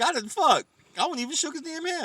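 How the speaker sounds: high-pitched indignant retort; mock indignation. Talking back to the TV news, mock indignation.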